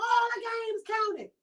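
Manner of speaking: high, slow mock shout; imitating a friend yelling about football; retold shout. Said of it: "So she's yelling at me".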